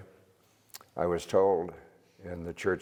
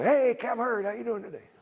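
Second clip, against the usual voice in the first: much higher and loud on the name, then easing off; calling out; 4 dB louder than usual. Retold or imitated. Greeting the captain across the sanctuary, teasing call. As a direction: call across the room with a cheeky grin.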